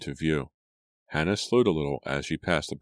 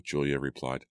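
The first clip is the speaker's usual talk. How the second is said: ordinary delivery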